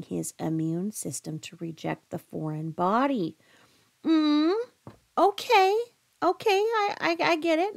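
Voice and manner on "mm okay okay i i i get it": much higher, louder "okay, I get it"; light up as it clicks; excited realization. Real, not acted. Proud realization.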